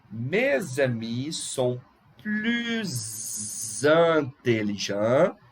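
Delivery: "plus intelligents" drawn out with a long buzzing Z; very slow, linger on the Z joining "plus" to "intelligents"; emphatic Z liaison. Shown as a demonstration. Teacher making students notice, exaggerated Z liaison in "plus intelligents". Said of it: "Eu fiz até com bastante ênfase".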